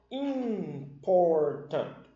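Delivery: important, drawn out slowly syllable by syllable; teacher stretching out important; slow model word. Shown as a demonstration. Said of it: "Então, ó".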